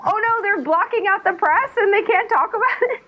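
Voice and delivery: high, wailing "oh no" panic; mock dismay. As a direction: whine it in rising horror. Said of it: "I was like".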